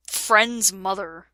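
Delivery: usual speaking voice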